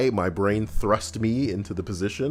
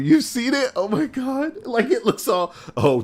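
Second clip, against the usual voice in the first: ordinary talk, nothing marked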